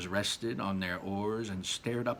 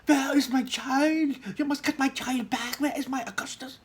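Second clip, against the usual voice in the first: high, loud frantic scream; screaming mother; 5 dB louder than usual. Acted. Mrs Gloop screams at Mr Wonka for her son. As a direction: shriek it like a panicked mother. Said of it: "screaming and hollering".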